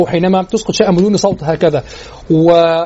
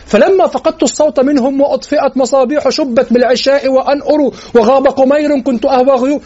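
إلقاء شعر؛ إنشاد بصوت أعلى طبقة؛ ارفع طبقة الصوت في الإنشاد؛ إنشاد شعري بطبقة أعلى; 6 semitones higher than usual. Acted. ينشد بيت عمر.

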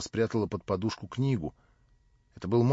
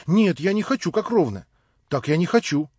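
put-on higher and louder, a stubborn girl's outburst; hot refusal; 8 semitones higher, 7 dB louder than usual. A narrator's reading. Nelli hotly refuses to be treated as Natasha's equal, louder and higher. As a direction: fling it out, refusing flatly.

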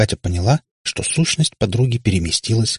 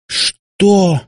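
high, loud gasp of outrage; breathless indignant cry; 11 semitones higher, 5 dB louder than usual. A narrator's reading. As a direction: choke out the word in outrage. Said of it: "задохнулась от возмущения".

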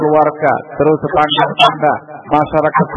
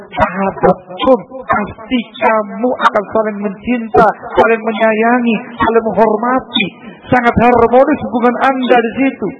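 solemn preaching; 8 semitones higher than usual. Acted. Quoting the Prophet.

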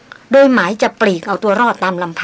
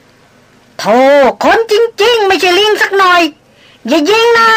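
put-on much higher, louder old man begging; frantic pleading; 11 semitones higher, 5 dB louder, much slower than usual. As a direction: beg desperately not to be shot. Old hunter pleading not be shot. (อ้อนวอนอย่างตื่นตระหนก เสียงสูงมาก)

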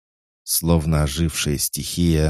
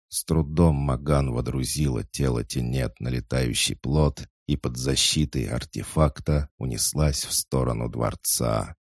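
ordinary delivery

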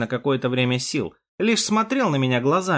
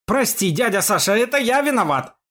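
higher, anguished apology; remorseful; 8 semitones higher than usual. A narrator's reading. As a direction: blame yourself with feeling. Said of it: "бил себя кулаком в грудь Яшка". (покаянный, взволнованный возглас)

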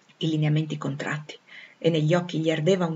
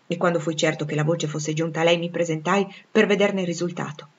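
plain reading voice

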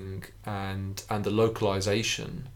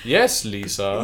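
a stilted, deliberate "Yes, Lisa" with a high lift on "Yes"; a playful nod to The Room's Lisa line; Tommy Wiseau impression; 8 semitones higher, slower than usual. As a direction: lightly mimic Tommy Wiseau's odd, stilted delivery. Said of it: "the way Tommy Wiseau saying it".

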